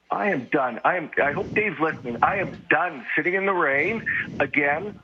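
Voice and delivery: normal talking voice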